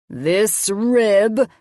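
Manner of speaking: drawn-out mad-scientist play; funny villain voice. A narrator's reading. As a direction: menace a child in fun. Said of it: "in a funny voice".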